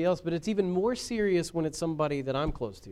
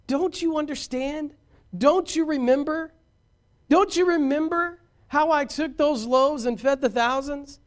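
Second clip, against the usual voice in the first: mild imitated teacher's rebuke; Jesus rebuking; 6 semitones higher, 4 dB louder than usual. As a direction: plead with them to remember. Jesus rebukes forgetful disciples.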